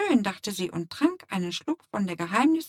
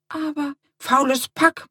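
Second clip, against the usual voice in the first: usual speaking voice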